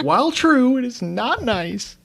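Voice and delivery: mock whiny father. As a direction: whine it like a hurt dad.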